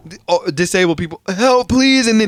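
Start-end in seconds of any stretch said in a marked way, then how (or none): 1.3-2.1 high, loud mock plea; retold panicked appeal; imitated cry for help. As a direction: call out like desperate passengers. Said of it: "all these people start".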